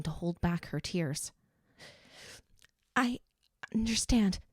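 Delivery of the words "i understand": usual speaking voice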